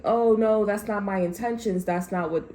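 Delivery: whiny fake denial of any bad intent; mock innocent excuse. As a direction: play the innocent, hammed-up. Mocking the other person's excuse.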